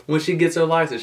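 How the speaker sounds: high, fast, breezy impression of the government dude; quoting the rich neighbor. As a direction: toss it off like buying a car is nothing. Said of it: "He was like".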